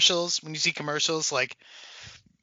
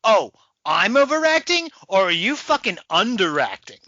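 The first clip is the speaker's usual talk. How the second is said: indignant retort; 3 semitones higher, 5 dB louder than usual. Acted. Actress answering critics of her overacting, indignant retort. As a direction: throw the accusation back, indignantly. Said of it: "And she's like".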